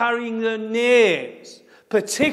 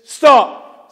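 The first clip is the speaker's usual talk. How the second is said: a sudden loud command, pitched higher; voice of God; 4 semitones higher, 8 dB louder than usual. Acted. God stopping Moses at the bush, loud. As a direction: bark it as God halting Moses. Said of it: "says God".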